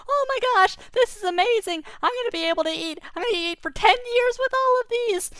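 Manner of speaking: very high, loud, squeaky glee; a rabbit who can eat for years; overjoyed rabbit. Acted. Rabbit rejoicing over ten years of food, squeaky and overjoyed. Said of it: "And he said".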